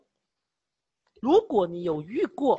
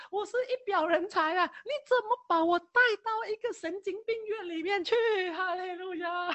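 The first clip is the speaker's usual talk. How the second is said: mock crying prayer; 9 semitones higher than usual. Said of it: "我当时是一边哭".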